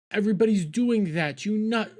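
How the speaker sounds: high, loud frustrated outburst; exasperated near-scream; real exasperation. Real, not acted. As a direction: burst out, fed up, pitch shooting up. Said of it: "Scream into a pillow".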